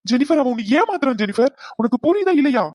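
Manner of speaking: agitated, high-pitched warning; urgent pleading. Acted. Father urgently warns daughter.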